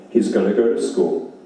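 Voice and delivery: normal talking voice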